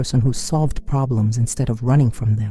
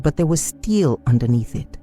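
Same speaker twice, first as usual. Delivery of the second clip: plain reading voice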